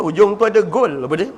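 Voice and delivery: ordinary talk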